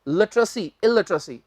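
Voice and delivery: usual speaking voice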